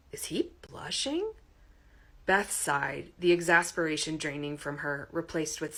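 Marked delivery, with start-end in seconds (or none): 0.1-1.3 high, slow, softer wonder; gently incredulous aside; amused surprise. A narrator's reading. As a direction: Beth wondering about Todd. Beth's surprised inner thought.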